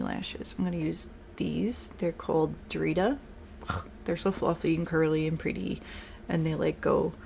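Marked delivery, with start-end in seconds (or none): none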